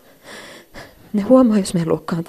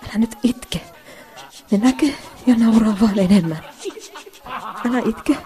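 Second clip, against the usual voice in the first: higher, tearful self-pleading; holding back tears; 3 semitones higher than usual. Acted. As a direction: beg yourself not to cry. Telling herself not to cry. (itkua pidätellen)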